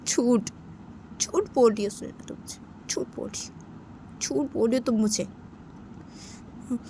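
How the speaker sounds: soft, low tearful accusing, slipping into a whisper; hurt girlfriend half-sobbing her words; mild tearful reproach; crying. Acted. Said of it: "Sunaina को ऐसे रोते रोते बोलती".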